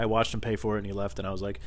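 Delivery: usual speaking voice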